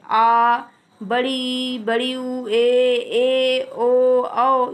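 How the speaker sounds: each vowel drawn out long; slow teacher recitation of आ, ई, ऊ, ए, ऐ, ओ, औ; long vowels demo. Shown as a demonstration. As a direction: hold each दीर्घ vowel like आ full length. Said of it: "ये सात होते हैं".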